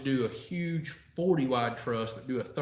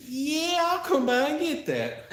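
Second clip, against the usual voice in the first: high-pitched reply; 12 semitones higher than usual. Retold or imitated. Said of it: "I said".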